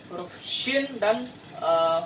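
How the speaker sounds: ordinary talk, nothing marked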